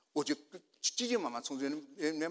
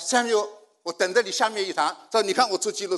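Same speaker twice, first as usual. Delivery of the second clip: higher, louder, excited voice; eager retold request; 6 semitones higher, 11 dB louder than usual. A listener accosts the preacher after the meeting, excitedly.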